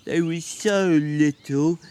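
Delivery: put-on slow childlike, sorry; apologetic. A narrator's reading. Lenny apologizing to George, slow and childlike. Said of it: "he said apologetically".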